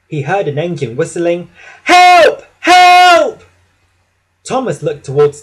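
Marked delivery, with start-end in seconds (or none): 1.8-3.4 high, loud panicked cry for help; desperate cry for help. A narrator's reading. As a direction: scream for help like a runaway engine. James whistling for help.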